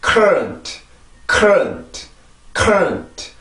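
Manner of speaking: "current" with strong first syllable and reduced ending; pronunciation drill. Shown as a demonstration. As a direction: punch cur in "current", swallow the schwa. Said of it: "한 번 더".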